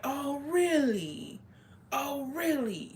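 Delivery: high, long drawn-out "oh, really"; mock-offended disbelief. Girl reacting to gossip about her brothers, mock-offended disbelief.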